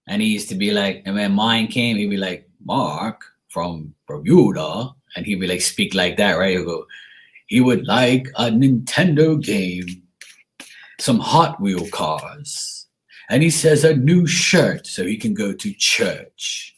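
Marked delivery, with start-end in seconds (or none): 2.6-4.9 put-on slow, drawn-out Bermudian accent reading out a name; imitating the Bermudian Santa on TV. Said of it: "That's exactly how he used to talk". 7.5-16.7 put-on slow Bermudian accent reading a kid's wish list; Bermudian Santa accent. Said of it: "That's exactly how he used to talk".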